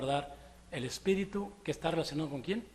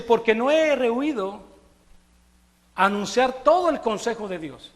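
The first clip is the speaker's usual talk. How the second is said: scripture reading; 4 semitones higher, 7 dB louder than usual. Acted. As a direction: read it out to the congregation with weight. Reads scripture aloud in sermon.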